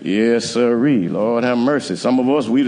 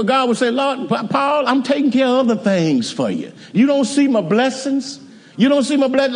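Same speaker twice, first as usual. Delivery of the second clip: voicing the Lord; 14 semitones higher than usual. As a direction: plead as God to Paul. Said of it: "the Lord said".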